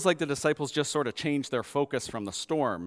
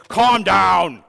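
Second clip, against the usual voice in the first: much louder, a harsh yell; yelled calm down; 3 semitones higher, 13 dB louder than usual. Retold or imitated. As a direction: snap it at the child. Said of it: "We don't yell at Anhumea".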